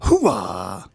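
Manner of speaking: slow, swooping actor impression; Al Pacino. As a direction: drag out the words, pitched high, then drop for the last. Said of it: "you know, Al Pacino".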